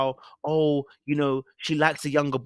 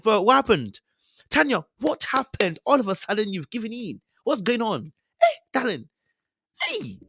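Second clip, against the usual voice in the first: high-pitched, incredulous calling-out; mock dismayed confrontation; 7 semitones higher than usual. Host confronting a contestant who gave in, mock dismayed. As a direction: plead in exaggerated disbelief, climbing to a high cry.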